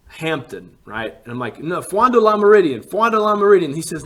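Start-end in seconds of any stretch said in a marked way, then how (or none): 1.6-3.7 retold insistent correction, high and loud; loud insistence. Passenger correcting the taxi driver, loudly insistent. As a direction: raise pitch and volume to get the name across.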